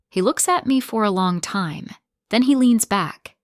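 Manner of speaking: ordinary delivery, nothing marked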